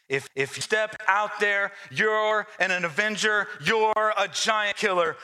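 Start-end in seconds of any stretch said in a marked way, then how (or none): none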